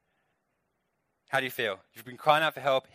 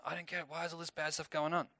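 higher, much softer bewildered whine; confused complaint; 3 semitones higher, 11 dB quieter than usual. Retold or imitated. Gideon still missing God's explanation, confused complaint. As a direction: imitate someone lost and complaining under their breath. Voices Gideon not getting it.

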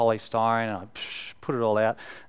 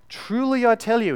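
higher and louder, each word weighted; emphatic Jesus quote; 11 semitones higher, 10 dB louder than usual. Retold or imitated. Preacher voicing Jesus to the congregation, emphatic and loud. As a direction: proclaim it like Jesus making a point. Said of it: "when he wants to make something emphatic".

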